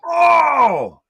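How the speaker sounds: high, loud gasp of shock; excited shout. Real, not acted. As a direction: yell out at the big spot. Big move in the match.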